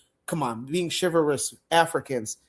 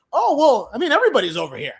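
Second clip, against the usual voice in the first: naive clueless answer; 8 semitones higher, 5 dB louder, faster than usual. Retold or imitated. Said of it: "You look like a fool".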